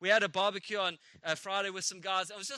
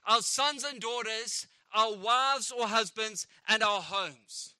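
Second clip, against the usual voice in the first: slow solemn commission; 3 dB louder, slower than usual. Retold or imitated.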